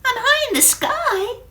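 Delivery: a tiny drop, suddenly puzzled and alarmed; Wendy's voice. Acted. Storyteller voicing the water drop for children, high and puzzled. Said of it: "she says".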